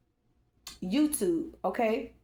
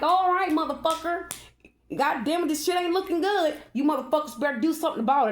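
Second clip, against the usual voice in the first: higher, louder cursing outburst, fast at the end; 7 semitones higher, 3 dB louder than usual. Retold or imitated. Spirit speaking through her during a tarot reading, loud high cursing. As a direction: let an angry spirit swear through you.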